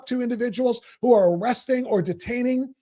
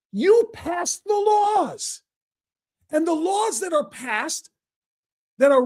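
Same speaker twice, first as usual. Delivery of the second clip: very slow, much higher shouted words; furious shout; 6 semitones higher, slower than usual. Real, not acted. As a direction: bellow it slowly, word by word. Angry host berating guest.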